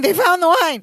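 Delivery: panicked yell. Retold or imitated. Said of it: "They both start yelling".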